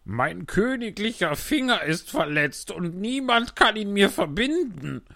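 high, slow, self-pitying moan; whining king. Acted. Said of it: "jammerte der König".

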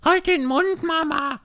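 high bratty voice, loud; a cheeky young daughter snapping back; young toad talking back to her mother; a bit cheeky. A narrator's reading. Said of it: "etwas naseweiß".

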